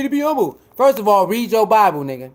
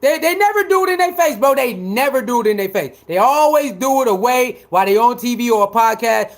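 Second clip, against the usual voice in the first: ordinary delivery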